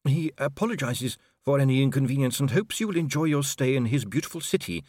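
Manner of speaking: a hint of diplomatic politeness; Inigo translating. A narrator's reading. Interpreter passes on the captain's apology, lightly voiced courtesy.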